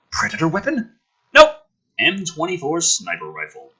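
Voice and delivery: ordinary talk, nothing marked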